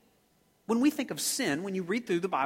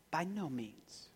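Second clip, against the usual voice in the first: soft, prim little refusal; a gentle, proper grandma saying no; a grandmother softly turning down a cookie request; mild grandmother voice; 12 dB quieter, slower than usual. Retold or imitated. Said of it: "you hear your grandmother saying".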